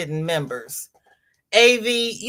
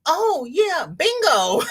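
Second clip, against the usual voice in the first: excited; 10 semitones higher, slower than usual. Retold or imitated. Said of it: "and I'm like".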